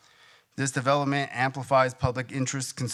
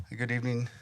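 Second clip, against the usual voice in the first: ordinary talk, nothing marked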